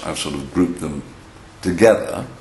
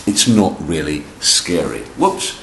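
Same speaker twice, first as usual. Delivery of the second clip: ordinary delivery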